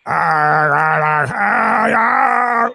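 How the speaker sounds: mock demonic ah sounds, going up; imitating possessed moans. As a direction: mimic the Exorcist demon moaning. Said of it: "They get that deep voice to go".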